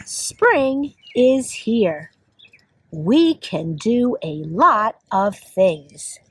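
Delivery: slow, measured picture-book reading; reading to children. Acted. Teacher reads a spring book to kids, slow read-aloud. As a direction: read slowly, each word spaced out for young children.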